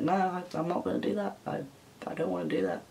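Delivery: very soft, small retold protest; meek refusal. Answering followers' hair advice, meek quiet refusal. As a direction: shrink it to a near murmur.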